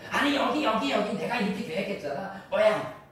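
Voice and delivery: high, loud bickering between spouses; mock quarrel. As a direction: raise your voice like a couple fighting over a trip. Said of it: "그 목소리 높여 싸우고".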